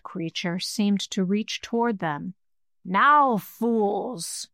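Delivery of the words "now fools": hate-filled rasp. Acted. Skull-bearer turns on the mortals, hate-filled rasp. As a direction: lash out with a monster's hatred. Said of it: "the hate-filled voice rasped at them".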